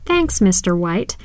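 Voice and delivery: usual speaking voice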